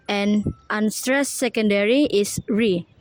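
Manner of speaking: normal talking voice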